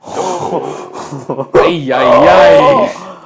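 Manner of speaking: loud high cry of glee; excited shout. Real, not acted. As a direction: belt out a joyful ay ay ay. Excited board game cry.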